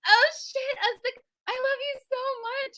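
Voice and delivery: high, breathless fan gushing; starstruck gush. Retold or imitated. Telling [name] she loves her, high starstruck gushing.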